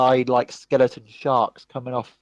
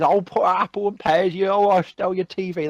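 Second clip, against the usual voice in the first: hammed-up Cockney, pitched higher; bad Cockney accent; 6 semitones higher than usual. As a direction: lay on a fake Cockney accent thick. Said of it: "he has the worst Cockney accent".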